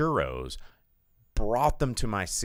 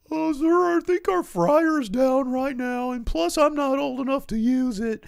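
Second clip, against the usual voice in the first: put-on high-pitched nervous teen cashier; 6 dB louder than usual. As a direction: squeak it like a young kid at the counter. Teen worker voiced in story.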